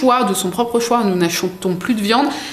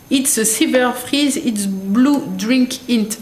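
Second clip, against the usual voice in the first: slow English with a French accent; halting English read with a heavy French accent; 3 semitones higher, slower than usual. Real, not acted. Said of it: "Oh, mais mon accent".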